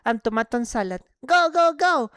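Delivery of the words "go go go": urgent shout. Real, not acted. Urging teammates in rush.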